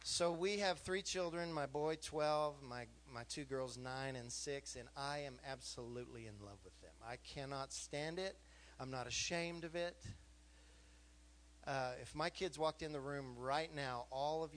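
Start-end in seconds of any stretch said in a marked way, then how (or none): none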